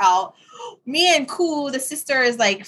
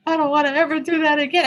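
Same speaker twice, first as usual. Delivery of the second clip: imitating her shaken daughter, fast and high; 6 semitones higher, faster than usual. Mother imitates daughter after a scary drive, fast and high. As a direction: rush it out like a scared teen driver. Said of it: "And she's like".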